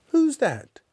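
very high, loud question; startled shout. Acted. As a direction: caught completely off guard. Fred startled by the visitor.

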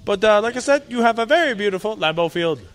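high, gushing real estate pitch; realtor voice. Pretend. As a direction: sell the house like a game show host. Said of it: "Is that a realtor voice?".

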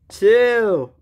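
high, loud, drawn-out count; ringing countdown call. Acted. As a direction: call out the count before a charge. Countdown before a charge.

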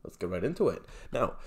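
normal talking voice

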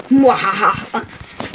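slow low evil cackle; drawn-out villain laugh, low; mad scientist laugh. Pretend. About to eat pie.

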